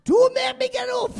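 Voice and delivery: high, slow whiny yell; mock shout. Comic drunk on a beanbag shouting he won't get up. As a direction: protest loudly from the beanbag.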